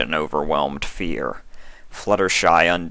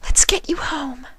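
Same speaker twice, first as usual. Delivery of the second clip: high, breathy whisper of a timid pony; gentle, shy, barely daring to ask; Fluttershy's voice. A narrator's reading. Fluttershy comforts monster.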